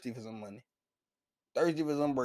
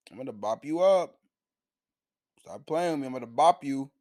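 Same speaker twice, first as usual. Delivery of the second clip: a louder, pushier second take of bop you up; louder kid threat; 6 dB louder than usual. Retold or imitated. Young Luffy squaring up to Higuma, louder insistent threat. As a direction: push the threat harder, louder.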